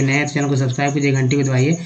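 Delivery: rapid, rattled-off channel plug; fast subscribe plug. Acted. Host rattles off channel links fast. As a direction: race through the links.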